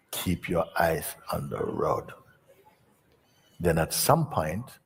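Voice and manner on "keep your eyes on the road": slow, low steady instruction; calm instructor. Retold or imitated. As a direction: settle him down slowly.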